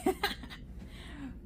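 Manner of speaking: high, breathy laughing fit; real laugh. Real, not acted. Tarot reader tickled by the card she drew, laughing. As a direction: crack up all over again. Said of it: "y'all gonna see why I'm laughing".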